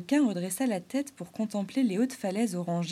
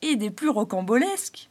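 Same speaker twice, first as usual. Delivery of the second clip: louder jester voice; Arlequin amused; 3 semitones higher, 6 dB louder than usual. Acted. Clown eyeing the springtail, louder. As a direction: tease with wonder.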